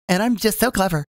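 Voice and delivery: ordinary delivery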